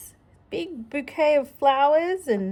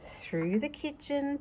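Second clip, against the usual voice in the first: ordinary delivery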